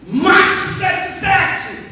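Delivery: slow, high, loud drawn-out proclamation; preaching at full force. Acted. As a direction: stretch each word out like a battle cry.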